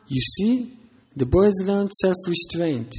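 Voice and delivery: pompous, pleased father lecturing; proud father. Acted.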